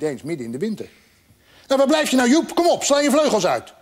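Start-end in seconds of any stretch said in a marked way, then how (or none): 1.7-3.7 high, loud impatient bird; pushy, pitched-up urging. A narrator's reading. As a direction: chivvy them along loudly. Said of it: "Het vogeltje werd ongeduldig".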